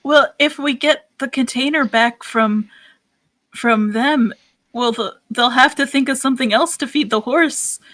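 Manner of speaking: character voice. Acted. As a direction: nervously quiz Raoul in character. Player voices Dickie again.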